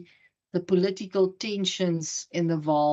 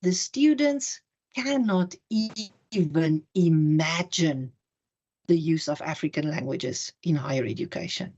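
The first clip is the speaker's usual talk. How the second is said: Neville Alexander, measured voice. Retold or imitated. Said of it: "in a very measured voice".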